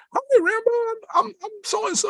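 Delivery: put-on very high, squeaky girly greeting. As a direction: put on a tiny falsetto for a young assistant.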